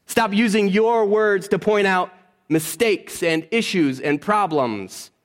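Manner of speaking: slow, deliberate pleading; slow emphatic preaching. Real, not acted. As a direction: spell it out slowly to the church. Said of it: "slow preaching emphasis".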